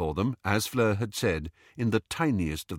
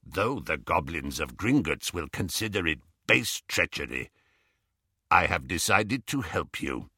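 low goblin voice, formal; Griphook, solemn goblin; 4 semitones lower than usual. Acted. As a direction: announce it gravely as a goblin.

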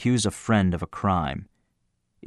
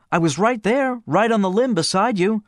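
much higher, upset and rattled; a voice pushed way up with shock; shocked friend; 12 semitones higher than usual. Acted. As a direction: blurt it out, badly shaken. Gene insisting he was there.

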